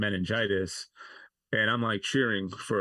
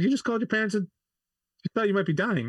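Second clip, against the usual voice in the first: much higher, louder mock wife; exasperated wife; 11 semitones higher, 3 dB louder, faster than usual. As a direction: imitate her disbelief at him. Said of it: "She's like".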